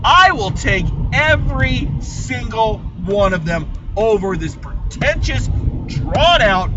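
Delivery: ranting about pretentious films, loud and defiant; passionate rant. Real, not acted. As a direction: declare it proudly and loudly. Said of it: "yes, I'm gonna say it".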